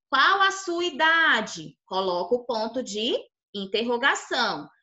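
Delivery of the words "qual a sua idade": "Qual a sua idade?" slow and high, questioning, stretched on idade; example question. Shown as a demonstration. Teacher modelling a question for the class, "Qual a sua idade?" asked slowly and higher. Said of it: "se você vai perguntar".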